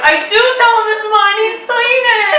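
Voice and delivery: high, loud whine of complaint; exasperated protest. Retold or imitated. Daughter complains to mom about dad, exasperated protest. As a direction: argue back at a high pitch.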